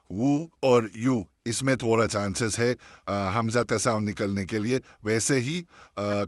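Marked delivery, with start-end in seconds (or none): 0.1-1.3 वू और यू drawn out slowly; slow damma demo. Shown as a demonstration. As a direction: stretch वू and यू slowly. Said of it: "दम्मा देते हैं". (धीमे खिंचे वू और यू)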